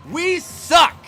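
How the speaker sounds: loud, high bitter outburst; a coach blurting the team's verdict to the reporter, loudly; fed-up coach. Pretend. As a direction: spit it out like a furious beaten coach. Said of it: "I got the answer right here".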